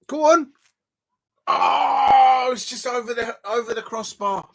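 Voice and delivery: high excited shout, then groan; urging the shot in, then it misses. Real, not acted. Shot just over the crossbar.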